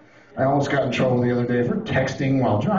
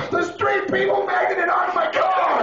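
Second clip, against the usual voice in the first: very high, drawn-out mock-furious scream; road rage; slower than usual. Driver finding cars stuck to his, enraged screaming. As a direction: scream it in comic outrage.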